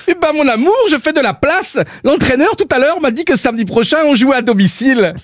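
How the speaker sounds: high-pitched attempt at a Belgian accent; wandering Belgian accent. Belgian footballer explaining to his wife, straying Belgian accent. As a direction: play the husband in a wobbly Belgian accent. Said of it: "le mari est parti, mais on sait pas dans quelle région".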